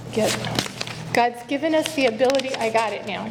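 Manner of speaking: ordinary talk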